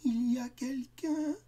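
high, slow, timid little question; children calling into the dark, scared; small frightened voice. Acted. Children in bed asking the dark voice, small and scared. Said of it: "tremblant de peur, demandèrent d'une petite voix".

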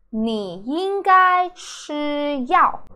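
ordinary talk

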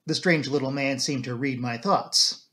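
ordinary delivery

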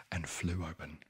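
plain reading voice

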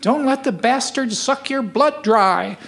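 put-on slow, high, quivering old lady voice. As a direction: quaver it out like a frail old woman. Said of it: "little high quivering voice said".

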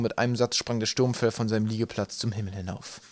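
usual speaking voice

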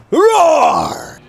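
loud hammed-up roar; mock beast roar. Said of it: "the Lone Wolf".

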